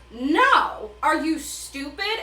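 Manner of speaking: mock exasperated Peeta. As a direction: make him blurt it out, incredulous. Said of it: "Peeta is the only one who goes".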